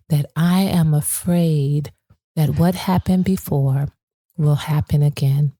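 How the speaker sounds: normal talking voice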